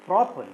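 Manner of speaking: "properly" with a loud opening PRO; "properly", strong start and quick fall; stress on first syllable. Shown as a demonstration. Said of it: "first syllable is stressed".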